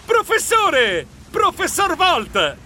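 high, slow call across the water; slow high shout. Acted. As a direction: call out to the professor, drawn out. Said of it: "Io gridai".

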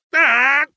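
short, high, loud "ah!"; startled yelp. Acted. Dazzled traveller hit by a lantern, startled yelp. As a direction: yelp as if caught off guard. Struck full in face by light.

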